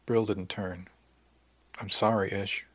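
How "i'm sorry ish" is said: ordinary delivery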